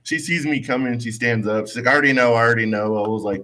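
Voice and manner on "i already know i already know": fast, rushed repeat; rattled off in a rush; hurried. Retold or imitated. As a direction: blurt it out quickly. Retold resident rushing her words.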